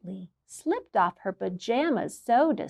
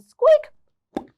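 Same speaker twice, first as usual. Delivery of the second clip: very high loud squeak; a shrill mouse squeak sound effect; 9 dB louder than usual. Acted. Reader making the mouse sound effect. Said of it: "I need a sound effect".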